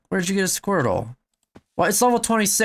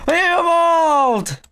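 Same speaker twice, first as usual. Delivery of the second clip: excited shout; 11 semitones higher, 5 dB louder, much slower than usual. Real, not acted. Repeated evolve cry.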